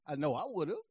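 ordinary delivery

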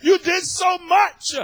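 high, loud cry of praise; excited, worked up. Retold or imitated. Preacher reliving his Bible reading, crying to God, all worked up. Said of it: "I'm getting so excited, I'm getting all worked up".

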